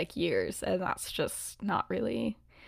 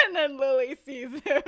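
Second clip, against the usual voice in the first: laughing while telling; 5 semitones higher, 4 dB louder than usual. Real, not acted. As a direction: barely get it out through the giggles. Teller cracking up at her twist.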